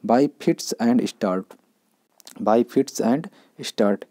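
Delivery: very slow, word by word; slow teacher dictation. Shown as a demonstration. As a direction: drag out each word so students can write it. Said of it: "teacher dictates idiom very slowly".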